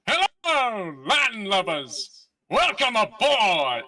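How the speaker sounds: slow, high hammy pirate greeting; purring latin lover. Acted. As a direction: stretch out landlubbers, grandly welcoming. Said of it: "you hear a voice go like".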